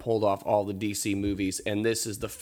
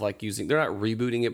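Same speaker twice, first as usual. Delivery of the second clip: normal talking voice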